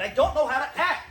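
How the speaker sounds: loud, half-laughing panic; panicky outburst. Real, not acted. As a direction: blurt it out in a frenzy. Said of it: "calm down".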